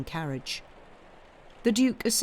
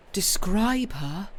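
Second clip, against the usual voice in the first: slow, higher, wondering echo; tender, smiling; 5 semitones higher, slower than usual. A narrator's reading. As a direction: savour the question with a tender smile. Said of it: "said David with a tender smile".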